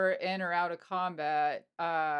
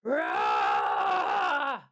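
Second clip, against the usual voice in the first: deep feral snarl, much lower; bloodlust roar; 4 dB louder than usual. Acted. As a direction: let out a wild beast-like growl. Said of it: "and she, like".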